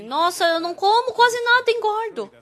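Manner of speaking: queixa caricata, mais aguda; imitando queixa. Retold or imitated. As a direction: imitando de leve quem reclama. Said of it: "algumas pessoas que dizem". (imitação leve de quem diz que não come e engorda)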